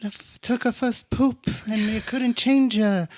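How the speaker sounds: high squeaky mock-sentimental wife voice; hammed-up sappy parent voice. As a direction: coo it like a doting mom. Mocking couple reminiscing.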